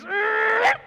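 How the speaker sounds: high, loud screech of a van pulling up; imitated car screech. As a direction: screech like braking tyres. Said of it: "He pulls in front of the Rolls Royce".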